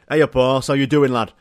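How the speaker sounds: loud Yorkshire lad greeting; Yorkshire accent. Retold or imitated. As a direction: call it out like a Yorkshire mate. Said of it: "horse in the Yorkshire accent".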